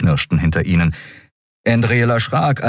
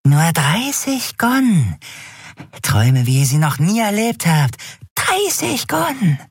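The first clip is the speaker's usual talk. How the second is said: very high, drawn-out sales pitch; crazed drug peddler; 11 semitones higher than usual. A narrator's reading. Dealer pitches vials to strangers, crazed and high. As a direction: tempt them in a piping, stretched-out voice.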